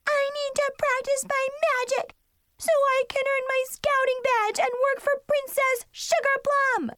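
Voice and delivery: put-on very high, insistent kid wail; screaming little sister. Little sister wants her scouting badge, high tantrum. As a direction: argue back in a squealing tantrum.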